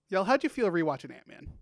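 ordinary delivery

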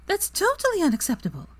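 disgusted and firm; indignant. A narrator's reading. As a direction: Hermione objects to marriage vows, indignant. Hermione indignant.

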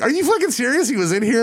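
high, disbelieving yell of outrage; incredulous outburst. Retold or imitated. Furious disbelief at being searched.

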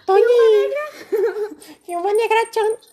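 very high cutesy anime voice; cute anime streamer. Pretend. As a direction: squeal the names sweetly.

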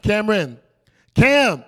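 escalating calls to someone pretending not to hear; insistent calling. Preacher calls on Cameron to play along, escalating insistent calls.

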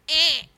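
loud, high imitated bird yell; bird squawk. Storyteller imitating island birds, aggressive squawk. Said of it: "like, aggressively yelling at us".

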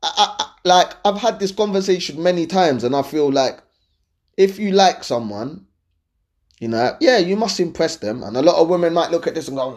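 ordinary talk, nothing marked

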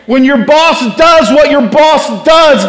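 high, loud, slow proclamation; impassioned preaching. Real, not acted. Preacher to workers with difficult bosses, impassioned. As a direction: ring it out to the congregation.

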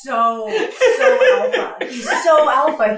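ordinary talk, nothing marked

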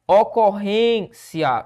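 "Ocorrência" slowly, broken before cia; syllable split. Shown as a demonstration. Teacher repeating for the class, "Ocorrência" split ocor-RÊN-cia. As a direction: lengthen RÊN in "Ocorrência", pause, then cia as one piece. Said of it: "o que eu tenho é ocorrência".

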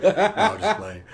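high, laughing through the words. Real, not acted. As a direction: laugh it off as a joke. Laughing off his joke pick.

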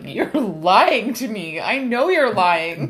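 high, loud, drawn-out accusation; mock indignant retort. Woman retelling her comeback to Google, mock indignant. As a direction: protest loudly, stretching the words in mock outrage.

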